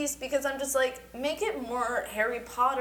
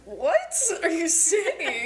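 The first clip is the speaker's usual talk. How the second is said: high, drawn-out appalled question; mock disbelief; 7 semitones higher, 3 dB louder, much slower than usual. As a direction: play the scandalized kid, stretching it out. Child shocked by parent's divorce joke.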